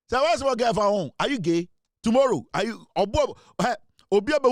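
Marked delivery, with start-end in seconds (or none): none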